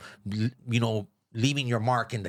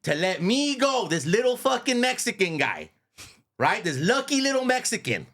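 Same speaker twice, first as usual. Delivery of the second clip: higher, taunting brag; mock-boastful; 9 semitones higher than usual. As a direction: gloat about the little Mexican. Mocking his own departure.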